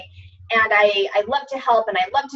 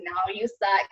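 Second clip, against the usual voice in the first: devil voice; 3 semitones lower, 6 dB quieter, slower than usual. Retold or imitated. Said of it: "angel and devil on my shoulders".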